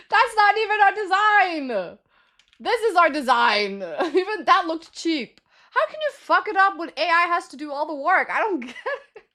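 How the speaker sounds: high-pitched, incredulous outburst; exasperated rant. Real, not acted. Streamer rants to viewers about a teammate's botched design, exasperated. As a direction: complain in a high, wound-up voice.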